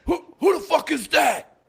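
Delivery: high, loud angry bellow; yelling officer. Retold or imitated. Warrant officer challenging an unknown new guy, yelling. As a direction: bark it from behind the office door. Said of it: "He's just yelling at me".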